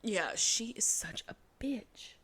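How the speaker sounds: emphatic but hushed confirmation; hushed agreement. Real, not acted. Host agrees with retold insult.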